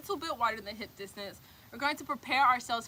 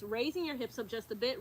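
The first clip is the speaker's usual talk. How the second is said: ordinary delivery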